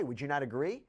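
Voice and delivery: ordinary delivery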